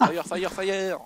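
loud, high urgent yell; shouting. Real, not acted. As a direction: yell it out as you shoot. Calling out while shooting bear.

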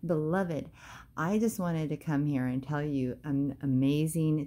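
usual speaking voice

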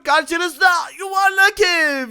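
high, loud, drawn-out announcement; slow showman's shout; grand unveiling. Pretend. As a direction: present it like a big show reveal. Unveiling the new house.

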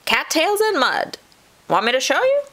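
high, proud animal voice; muskrat calling. A narrator's reading. Muskrat offering to show possum, calling. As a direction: show off, pitched well up. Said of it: "called the muskrat".

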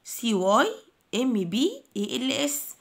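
letters in groups with short pauses; spelled in chunks. Shown as a demonstration. Teacher repeats the spelling for pupils to memorise, in chunks. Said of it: "تحفظوها سبيلنج بنفس الطريقة".

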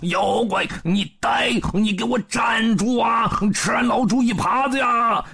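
Pigsy faking a chase, yelling; mock chase shouting. A narrator's reading. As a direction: holler after him for show. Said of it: "吆吆喝喝的在后面追".

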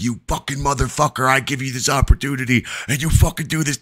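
re-enacted furious telling-off; going off on him. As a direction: tear into him in a rushed rant. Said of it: "going off on him".

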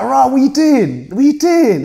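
baffled shout at a wannabe gangster; incredulous. Retold or imitated. To lads posing in car parks, a loud incredulous shout.

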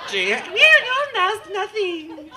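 high, slow, drawn-out mock whine; stretched nasal-free mock voice, high and slow. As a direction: whine it like a mocking kid. Mocking the crowd's silence.

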